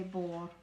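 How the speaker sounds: bor with no D at the end; silent D. Shown as a demonstration. Teacher showing the silent D in bor. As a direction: say bor, leaving off the D. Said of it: "we don't pronounce the D on the end".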